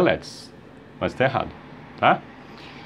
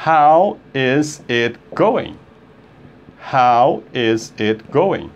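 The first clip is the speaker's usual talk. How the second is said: slow, word by word; slow demo; much slower than usual. Shown as a demonstration. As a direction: spell it out slowly, each word separate. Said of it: "Se você falar devagar". (bem devagar, palavra por palavra)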